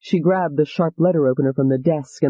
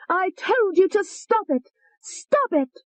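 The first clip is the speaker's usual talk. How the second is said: much higher slow frenzied yell; stabbing the man, yelling in frenzy, much higher; slower than usual. Acted. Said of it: "yelling at him".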